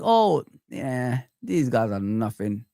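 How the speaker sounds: low, slow dismissive mimic; mocking smug fan. Fan mocking Arsenal supporters' dismissive attitude, low and slow. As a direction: sneer it out as the arrogant fan. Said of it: "they think".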